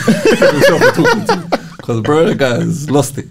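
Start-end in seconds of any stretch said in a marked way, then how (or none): 0.1-1.7 words squeezed out through a high laugh; cracking up mid-sentence. Real, not acted. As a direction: laugh while you say it. Laughing at Mourinho's decline.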